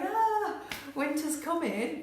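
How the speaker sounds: high, loud hammed-up wail; panicking in a t-shirt; mock panic. Mocking people running around.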